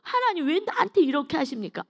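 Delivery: high-pitched plaintive cry to God; mock lament, pitched well up; imitated crying out. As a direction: act out crying out in protest. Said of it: "막 부르짖는 거예요".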